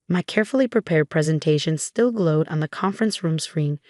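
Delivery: ordinary delivery, nothing marked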